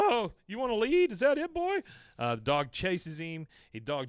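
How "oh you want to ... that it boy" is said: high, loud mock yell at a dog; alarmed yelling. Owner to his attacking dog, high-pitched yelling. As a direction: call out high over the dog's attack.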